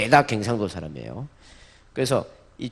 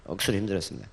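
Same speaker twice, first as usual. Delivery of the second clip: 억수로 힘들었습니다 dropped low, soft and fast; quick quiet Gyeongsang aside; muttered Gyeongsang dialect; 5 semitones lower, 8 dB quieter, much faster than usual. Pretend. Preacher repeats Solomon's line, soft fast Gyeongsang mutter. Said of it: "뭐 이런 이야기 할 것 같아요".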